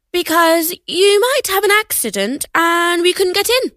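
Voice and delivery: lightly voiced mother; a hint of a patient mum. Mum answers teen daughter.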